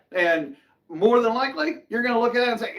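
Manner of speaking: normal talking voice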